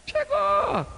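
very high, held, light voice; thin high announcement; high drawn-out call. Acted. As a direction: sing it out high and long. Preacher dramatizing an arrival.

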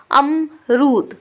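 अमरूद said very slowly, short अ at the start; slow drawn-out demo. Shown as a demonstration. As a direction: stretch out अमरूद slowly for the class. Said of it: "आ की कितनी आवाज़ आ रही है". (अमरूद धीरे-धीरे, छोटे अ के साथ)

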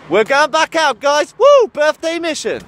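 loud, high hyped-up call; excited. Real, not acted. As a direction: pump up the crowd with a whoop. Heading out, hyping viewers.

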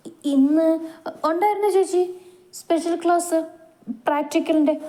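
nervous fib. Acted. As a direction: blurt a shaky excuse, head bowed. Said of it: "അവൾ മുഖം കുനിച്ചുകൊണ്ട് പറഞ്ഞു".

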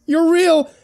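put-on high, overwrought girl's cry; sobbed exclamation. A narrator's reading. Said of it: "she sobbed".

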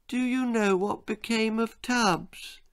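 put-on high, small boy's voice; boy's sudden question. A narrator's reading. Bill suddenly asking his mother about Tubbs, boyish. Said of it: "he asked suddenly".

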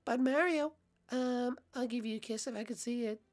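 soft, coy falsetto; Peach. Acted. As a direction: coax him quietly in a high princess voice. Peach coaxes Mario.

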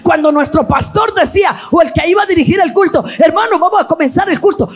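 rising, sing-song preacher's cadence; impassioned preaching. Real, not acted. Preacher recalls young people kneeling before the service, impassioned preaching.

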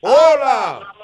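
loud greeting. Real, not acted. Host answering a call loudly.

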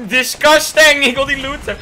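loud high disgusted cry; disgusted outburst. Real, not acted. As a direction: shout it in disgust. Disgusted by loot. (luid walgend)

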